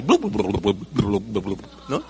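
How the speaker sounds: low, burbling fish noises; deep bubbly fish babble; fish talk. Pretend. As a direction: gurgle like a little fish underwater. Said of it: "Así hablan los peces".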